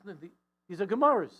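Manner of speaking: wife pressing her point loudly; 1 insistent protest. Retold or imitated.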